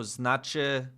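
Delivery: drawn-out, careful word study; slow spelled-out word. Shown as a demonstration. Learner puzzling over a Polish word, slow. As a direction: stretch the new word out slowly.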